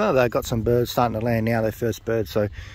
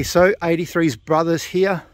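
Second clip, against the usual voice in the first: usual speaking voice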